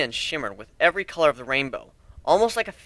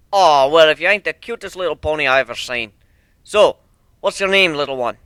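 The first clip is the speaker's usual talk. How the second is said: put-on folksy old stallion; 9 dB louder than usual. A hint of a folksy stallion greeting the ponies and counting them. Stallion coaxes shy pony.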